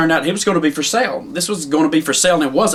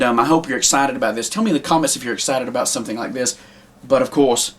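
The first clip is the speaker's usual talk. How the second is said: ordinary delivery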